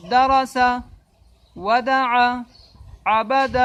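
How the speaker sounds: teacher showing fluent reading; fluent reading demo. Shown as a demonstration. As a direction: read each word whole without spelling. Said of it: "جیسے".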